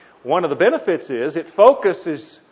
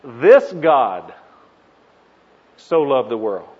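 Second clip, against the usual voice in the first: ordinary talk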